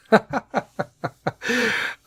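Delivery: long high laugh. Real, not acted. Podcaster laughing at his own dad joke, long laugh. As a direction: crack up at your own joke.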